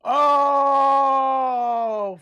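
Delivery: loud high laughing cry; laughing shout. Real, not acted. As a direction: burst out laughing in disbelief. Laughs loudly in disbelief.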